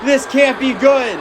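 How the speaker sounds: high, shouting hype call; wrestling commentator. Acted. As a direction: scream the big spot to the crowd.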